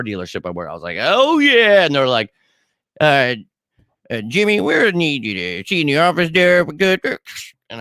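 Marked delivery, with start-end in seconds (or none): 1.0-1.8 high, loud self-satisfied "oh yeah"; a hammed-up proud whoop; smug cheer. Retells his proud reaction. 3.0-6.6 a mock awkward manager, higher pitched; hesitant boss. Voices the dealership managers calling him in.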